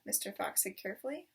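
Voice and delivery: plain reading voice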